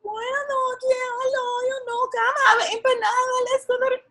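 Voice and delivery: a hint of a Spanish-speaking mother inviting you in; mother's Spanish-flavoured welcome. Friend's mother welcoming guest in Spanish-accented English. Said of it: "In this incomprehensible Spanish".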